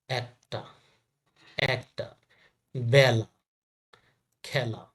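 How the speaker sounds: একটা, বেলা, খেলা with an open "æ". Shown as a demonstration. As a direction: say "ækta, bæla, khæla", each word set apart. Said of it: "এ উচ্চারণ". (একটা, বেলা, খেলা-তে "অ্যা" উচ্চারণ)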